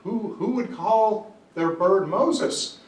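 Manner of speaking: high, loud, drawn-out disbelief; hammed-up incredulous question, very slow; shocked burglar. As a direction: play the burglar aghast for a joke. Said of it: "the burglar is shocked".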